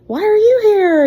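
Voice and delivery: high, loud quizzical question; curious parent voice. Retold or imitated. Parents asking the teacher why she is there, high and loud. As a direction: ask it like a nosy parent at the game. People questioning her at games.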